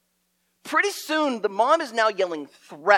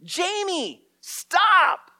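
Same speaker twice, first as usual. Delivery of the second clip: high loud scolding yell; angry yell; 12 semitones higher, 6 dB louder, much slower than usual. Retold or imitated. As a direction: shout it like a mom chasing her kid. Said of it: "I hear".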